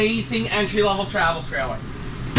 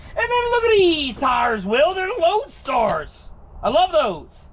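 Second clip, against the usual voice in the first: excited; 6 semitones higher, slower than usual. Real, not acted. Excited about the tires.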